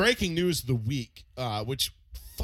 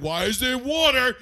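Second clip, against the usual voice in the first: loud, high, slow yell; angry dad; 10 semitones higher, 8 dB louder than usual. Retold or imitated. Dad yelling upstairs about water in the sink. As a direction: hammer every word like a raging father. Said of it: "be like".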